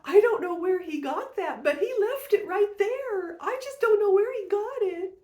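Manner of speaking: high, loud put-on old lady voice; quoting the grandma in a high, loud mocking voice; imitating the grandma. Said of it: "she points at it and goes".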